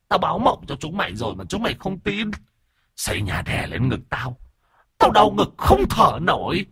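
very high, strained ghost voice hurling curses; cursing ghost. A narrator's reading. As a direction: spit the curses out as the angry spirit. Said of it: "bắt đầu chửi".

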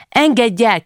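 high, urgent cry; child's shout. A narrator's reading. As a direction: call out like a child struggling free. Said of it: "kiabálta".

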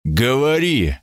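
stern. A narrator's reading. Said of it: "велел я жёстко".

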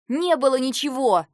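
very loud, high denial; full-voiced furious yell. A narrator's reading. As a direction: scream the denial at him. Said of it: "заорала я".

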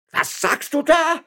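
very high, slow, shocked yell; cried out. A narrator's reading. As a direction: shriek the question in disbelief. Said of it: "schrie Herr Juan Lopez".

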